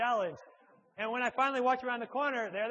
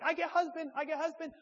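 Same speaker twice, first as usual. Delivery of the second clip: a light retold Korean accent, a touch higher; imitating a Korean accent; 7 semitones higher than usual. Wife calling for her husband, lightly imitated Korean accent. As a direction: mimic the Korean wife's broken English in a Korean accent. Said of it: "has a pretty thick Korean accent".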